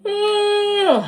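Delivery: yawning mid-word. Real, not acted. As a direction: let a big yawn take over. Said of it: "yawning is movement of energy".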